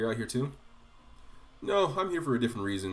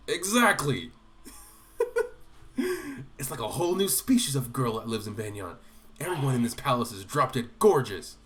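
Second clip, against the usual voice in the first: louder eager enthusiasm; lecherous glee; 5 semitones higher, 6 dB louder than usual. Pretend. Player voicing Gatrie gushing over handmaidens, louder. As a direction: gush about the girls.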